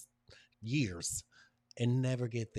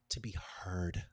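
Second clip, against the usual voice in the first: whisper; 2 semitones lower, 4 dB quieter than usual. Real, not acted. Whispered affirmation.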